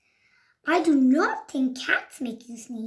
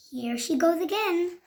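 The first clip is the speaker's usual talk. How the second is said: plain reading voice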